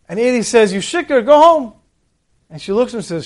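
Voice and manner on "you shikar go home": high, loud mock rebuke; acted scolding. Eli to the woman praying, indignant scolding. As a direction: order the drunkard out, voice raised.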